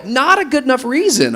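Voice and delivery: very high, loud, laughing dismissal; comic high-pitched verdict, punched out loud; emphatic rebuttal. Real, not acted. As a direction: shout the excuse down, half amused. Loudly rebutting the second excuse.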